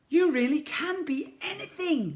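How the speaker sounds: high, excited wonder; joyful cry. A narrator's reading. Ned flying on the slime eagle, crying out in joy. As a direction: cry it out in amazement. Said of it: "cried the boy".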